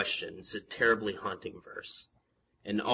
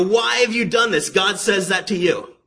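high, loud confronting question; impassioned preaching; 9 semitones higher, 8 dB louder than usual. Real, not acted. Preacher tells listeners God asks them, impassioned challenge. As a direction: fling the question straight at the congregation.

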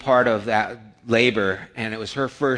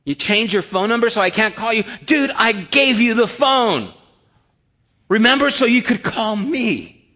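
high, louder incredulous protest; exasperated scolding; 8 semitones higher, 4 dB louder than usual. Retold or imitated. Scolding young man over phone.

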